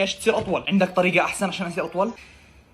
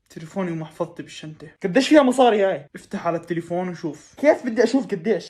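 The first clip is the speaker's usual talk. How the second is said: normal talking voice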